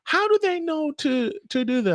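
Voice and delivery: very high, imitated bewilderment; retold astonishment in a high-pitched voice; amazed question.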